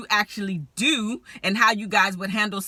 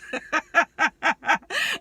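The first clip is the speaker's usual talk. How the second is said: real higher laugh; a giddy laugh; laughing at her own threat; 8 semitones higher than usual. Real, not acted. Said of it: "I'm laughing".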